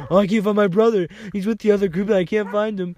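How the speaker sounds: high, loud mock panic; hammed-up frantic worry. Guy from New York panicking over his brother, loud mock panic. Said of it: "started panicking".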